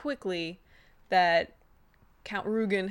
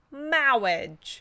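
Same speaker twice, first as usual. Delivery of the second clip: solemn, drawn-out clergyman, louder and higher; 5 semitones higher, 7 dB louder than usual. Retold or imitated. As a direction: intone it like a pompous wedding vicar. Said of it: "the most wonderful clergyman voice ever".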